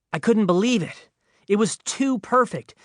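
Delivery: thrilled it's all too perfect; gleeful disbelief. Real, not acted.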